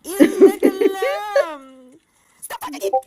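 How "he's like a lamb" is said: slow, loud laughing exclamation. Real, not acted. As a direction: laugh it out, drawn out. Laughing at a creature on screen.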